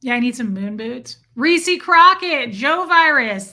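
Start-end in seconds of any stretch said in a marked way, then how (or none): 1.3-3.5 loud, slow, higher name call; excited. Real, not acted. Streamer greeting viewers by name excitedly. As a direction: shout the names out with delight.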